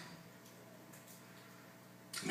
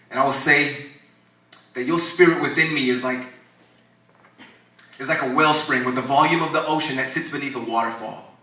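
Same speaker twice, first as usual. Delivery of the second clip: bold declaration; 3 semitones higher, 7 dB louder than usual. Acted. Declaring faith loudly.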